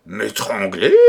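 loud, shocked cry; the king cries out, stunned by the threat; alarmed cry. A narrator's reading. The king, told of a plot against him, cries out in shock. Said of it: "s'écria le roi".

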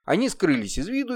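plain reading voice

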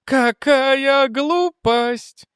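high, very slow singsong; deranged lieutenant. Acted. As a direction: stretch it out high, lost in madness. Deranged lieutenant talks to himself. (высоко и нараспев, как безумец)

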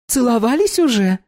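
put-on bright high young woman; high girl voice. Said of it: "внезапно спросила Доминика".

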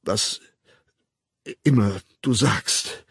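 slow, broken, hesitant promise; halting stammer with long gaps. A narrator's reading. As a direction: stall after the first word, then labour on. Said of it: "dann stammelnd".

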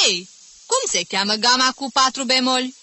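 high, loud pointing at pupils one by one; impatient stern teacher. Acted. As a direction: jab each pupil with the question. Teacher pressing each pupil. (profesor sever, nerăbdător)

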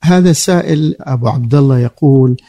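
usual speaking voice

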